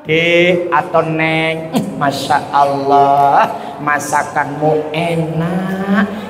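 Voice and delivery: husband gently praising his wife's cooking; gentle praise. Acted. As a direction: sweet-talk your wife slowly. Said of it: "ngomongnya lembut".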